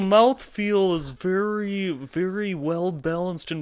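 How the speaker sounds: slow, high mock connoisseur voice; snooty beer critic. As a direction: parody a pompous taster.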